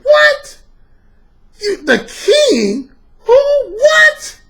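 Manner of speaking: outraged husband yelping in disbelief; a husband hearing his wife say king; stunned husband. Retold or imitated.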